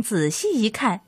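usual speaking voice

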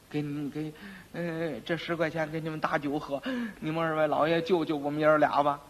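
soft, hesitant, ingratiating plea; pleading bribe. Acted. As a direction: offer money quietly and beg a favour. Quack quietly bribes, begging help.

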